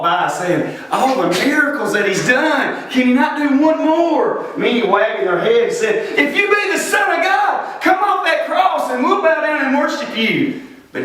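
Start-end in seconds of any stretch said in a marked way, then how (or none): 0.9-4.5 pitched-up taunting question; jeering passers-by. Retold or imitated. Passers-by walking past the cross, high imitated jeer. 6.2-10.6 very high challenging cry, easing down slightly; taunting. Retold or imitated. Onlookers challenging Jesus on the cross, high imitated taunt.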